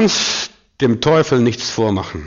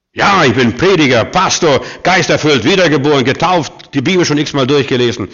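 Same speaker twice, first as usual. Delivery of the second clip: loud, raised-pitch protest; indignant outburst, louder and higher; exploding indignantly; 4 semitones higher, 5 dB louder than usual. Retold or imitated. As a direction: reel off credentials as if about to explode. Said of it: "so fast explodiert".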